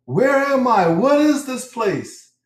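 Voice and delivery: high, bewildered questions; high confused voice. Retold or imitated. Retelling lost man's questions.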